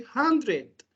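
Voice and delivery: slow British 'hundred' with a clear d and r; British hundred. Shown as a demonstration. Teacher models British 'hundred' for students, slow and clear. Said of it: "So in British English, we say".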